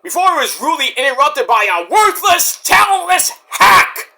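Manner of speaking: rising to a high furious shout; MJF mock rant. Fan retelling MJF insulting a rival, building to a high shout. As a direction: spit out the insult, building to a yell. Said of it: "he's like".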